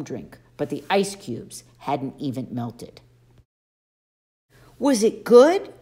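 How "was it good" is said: put-on high, louder, boyish satyr voice; Grover, eager. A narrator's reading. Satyr asks Percy how the drink tasted, eager high voice. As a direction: ask longingly about the drink. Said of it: "Grover asked".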